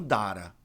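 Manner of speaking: "data" as DAH-ra, open A and tapped T; wrong open A. Shown as a demonstration. Teacher showing a common mistake, DAH-ra. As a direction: say "data" with a wide "a", dara. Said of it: "mas não dara".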